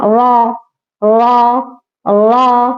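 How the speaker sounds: रॉ said thick and full; teacher sounds रॉ heavy. Shown as a demonstration. Teacher models heavy रॉ again for students. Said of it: "मोटा पढ़ना".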